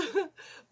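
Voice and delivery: high laughing burst; nervous laugh. Real, not acted.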